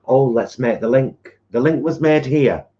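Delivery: put-on old newsreel announcer voice; loud, grand wartime newsreader; 1940s newsreader voice. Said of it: "a nineteen forties kind of newsreader voice".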